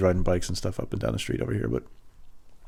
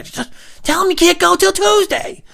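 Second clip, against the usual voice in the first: extremely high, loud, drawn-out order; screeching parent; 8 dB louder than usual. Retold or imitated. Parent repeats the order during child's call, screeching yell. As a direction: stretch it into a squealed yell.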